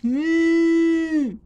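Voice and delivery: high, drawn-out mooing cow. Acted. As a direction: moo it long like a cow. Cow sound in refrain.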